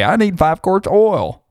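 high, lightly voiced fifties customer; a hint of an old-timey driver; customer at a garage. A fifties driver asking the garage for oil, lightly voiced. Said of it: "and say".

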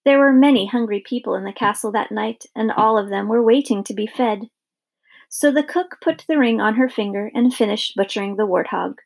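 ordinary delivery

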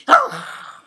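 high loud shrieking scream; raccoon shriek. Retold or imitated. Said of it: "great impression of a raccoon".

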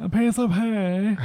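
high, very drawn-out posh English; posh English accent. Retold or imitated. Repeating the child's request for a listener, mock posh English accent. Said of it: "posh accents".

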